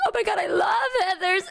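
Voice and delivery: high, excited fan squeal in words; mock fangirl excitement; imitated gushing. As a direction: gush like a delighted fan. Retold fan gushing over musical.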